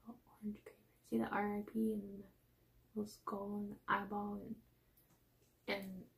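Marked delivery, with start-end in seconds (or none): 0.1-1.1 quick soft whisper. Real, not acted. Whispering at the graveyard sticker. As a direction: whisper it fast.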